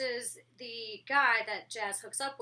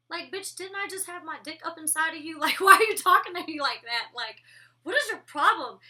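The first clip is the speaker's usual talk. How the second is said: mock outrage; 5 semitones higher, 9 dB louder than usual. Voicing Mike's outrage at Jazz.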